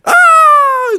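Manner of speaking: loud, very high mock scream; falling scream. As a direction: cry out like a swatted bird. Swatted owl screams.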